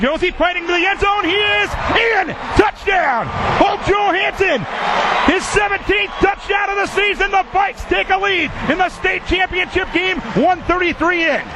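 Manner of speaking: high shouted touchdown call. Acted. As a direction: yell it as he crosses the line.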